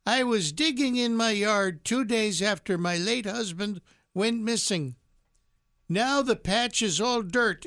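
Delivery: put-on high, slow sweet old lady voice. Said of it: "read this in an old lady voice".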